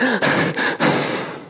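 high airy strained sigh; tense high sigh. Shown as a demonstration. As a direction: let out a tight, panicky sigh. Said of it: "suddenly I'm going".

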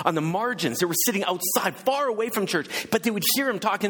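high, ringing preacher's lift; rising sermon. Acted. Preacher rousing the congregation about Jesus, impassioned. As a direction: preach it out to the back rows. Preaching builds to full force.

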